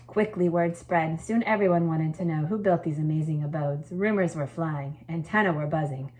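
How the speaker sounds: lively read-aloud, lifting the key words; storytelling voice. A narrator's reading. Teacher reading a story to her class, lively storytelling voice. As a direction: read it like a picture book to a class.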